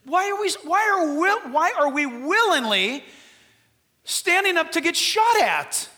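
loud, high, pleading sermon cry; full-force preaching. Acted. Preacher demanding an answer from the church, loud and high. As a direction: hammer the question home.

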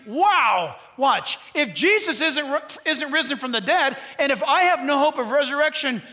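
loud, driving sermon voice; full-force preaching. Acted. Preacher pressing the church, loud and driving. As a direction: preach it hard to the congregation.

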